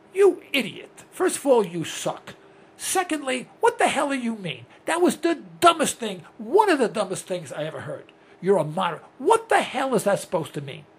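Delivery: imagined scolding of the senator, heated; angry rant. Retold or imitated. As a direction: tear into him with indignation.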